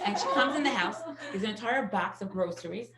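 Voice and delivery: ordinary talk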